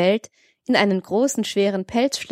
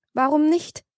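quick, spirited rise, much higher; lively retort; 8 semitones higher than usual. A narrator's reading. Severin countering his friend's objection, lively. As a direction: toss the question back with relish. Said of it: "rief er lebhaft".

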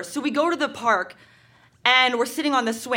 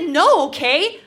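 put-on higher-pitched teen protest; exasperated refusal; 4 semitones higher, 4 dB louder, slower than usual. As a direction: push back at the boyfriend, pitch rising. Acted teenage quarrel with boyfriend.

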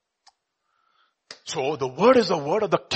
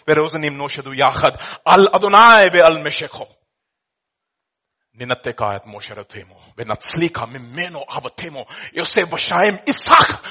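Hebrew psalm reading; 4 dB louder than usual. Acted. Preacher reciting the psalm in Hebrew for the congregation.

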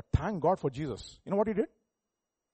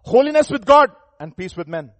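loud, raised preaching burst; emphatic proclamation; 4 semitones higher, 14 dB louder than usual. Real, not acted. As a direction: proclaim it forcefully, then ease off. Preacher proclaims the word just emphasised.

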